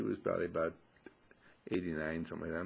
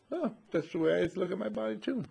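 put-on old man voice; 11 semitones higher than usual.

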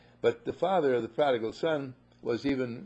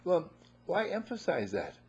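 normal talking voice

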